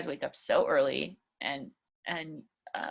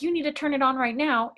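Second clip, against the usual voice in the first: demanding; 6 semitones higher than usual. Shown as a demonstration. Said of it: "So being like".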